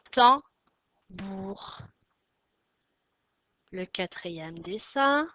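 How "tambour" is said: "tambour" in two spaced beats, tam, bour; syllable by syllable. Shown as a demonstration. Teacher names the drawing for pupils, syllable by syllable. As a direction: hit tam loudly, pause, then bour in "tambour". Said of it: "Deux syllabes".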